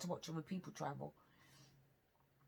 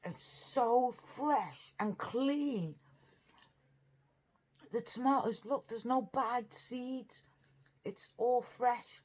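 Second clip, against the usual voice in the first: slow savoring praise; 6 semitones higher, 4 dB louder, much slower than usual. Real, not acted. Savouring fresh food on camera.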